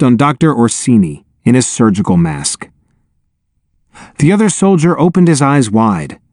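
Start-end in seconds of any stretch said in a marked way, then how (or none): none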